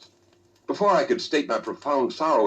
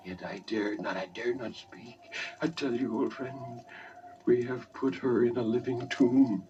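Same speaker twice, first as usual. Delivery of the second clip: slow, softer, hushed confession of a haunted man; gibbering murmur; 4 dB quieter, slower than usual. Acted. Roderick murmuring his dread to his old friend, slow and hushed. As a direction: whisper it as if the vault could hear you. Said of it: "he spoke in a low, hurried, and gibbering murmur".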